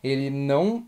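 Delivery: ordinary talk, nothing marked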